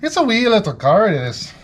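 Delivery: sing-song "wee little car" in a mock Irish-Minnesota accent. Mechanic practicing Irish, mock Irish-Minnesota accent. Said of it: "they sound like they're from Minnesota".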